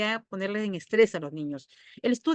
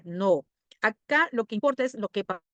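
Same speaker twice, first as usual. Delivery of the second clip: ordinary talk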